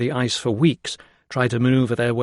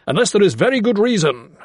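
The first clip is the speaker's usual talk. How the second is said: higher and louder sharp retort; barking great man; 9 semitones higher, 5 dB louder than usual. A narrator's reading. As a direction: snap the warning at the young doctor. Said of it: "he barked".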